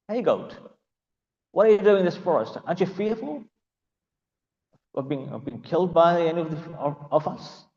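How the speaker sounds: sneering forest predators closing in; taunting jackals. Acted. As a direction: jeer at the goat from the dark. Jackals taunting the lost goat.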